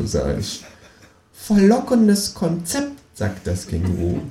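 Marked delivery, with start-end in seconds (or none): 1.3-3.0 high, pushy kangaroo voice; a cheeky high voice for the Kangaroo. A narrator's reading. Känguru nags the narrator to clean the bath, high and pushy.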